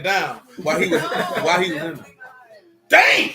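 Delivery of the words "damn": a high, loud, stunned "Damn!"; shocked exclamation. Real, not acted. Hearing she dumped a jailed boyfriend, a loud shocked cry. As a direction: let it burst out in disbelief. Shocked reaction to girlfriend's story.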